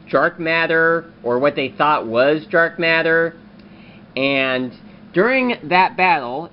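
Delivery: ordinary talk, nothing marked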